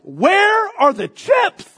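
high loud hammed-up yell, slow; mock angry shout. Craving man demanding chips from his wife, hammed-up shouting. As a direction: bellow for the chips in mock rage. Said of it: "I'm mad".

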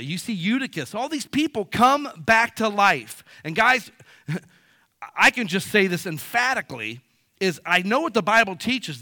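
preaching at full force. Real, not acted. Pastor preaches the resurrection to his church, at full force. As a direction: drive the gospel home like a revival preacher. Preaching builds to personal testimony.

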